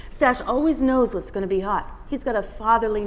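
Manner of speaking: plain reading voice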